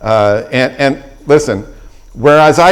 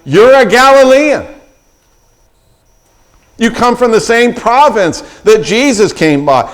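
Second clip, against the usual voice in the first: accusing bystanders; 7 semitones higher than usual. Retold or imitated. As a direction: call him out like a suspicious crowd. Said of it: "they are saying".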